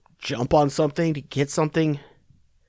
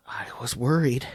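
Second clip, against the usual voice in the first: breathy whisper, no voice; 3 semitones lower, 5 dB quieter than usual. Acted. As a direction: drop to a secret whisper. Whispered repeat of mock concern.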